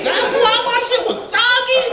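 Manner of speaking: very high, squeaky shocked question; stunned, cracking up at the news; shocked disbelief. Retold or imitated. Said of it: "I was like".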